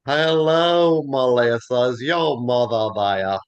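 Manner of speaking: slow, drawn-out Southern phone hello; imitated Southern grandma accent; Southern grandmother. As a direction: stretch every word into extra syllables, Southern style. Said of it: "her grandmother used to call up and go".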